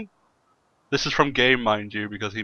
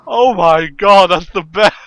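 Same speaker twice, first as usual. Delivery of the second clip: much higher and louder, slow delighted cry; 8 semitones higher, 12 dB louder, slower than usual. Real, not acted. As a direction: gush with real glee. Delighted by the episode name.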